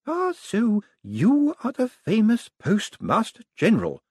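put-on high, slow old lady voice; old lady, knowing. A narrator's reading. Old lady greeting the Postmaster, high and slow. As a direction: size him up slowly. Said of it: "said Missus Lavish".

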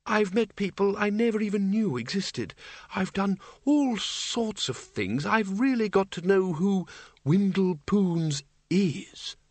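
high, musing wizard's voice; wistful old Windle. A narrator's reading. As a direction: reflect aloud in a high, lingering voice. Windle's voice in the story.

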